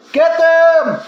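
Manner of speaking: loud high command to the mob; yelled. A narrator's reading. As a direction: yell it to set the crowd on them. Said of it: "yelled old lady number pie".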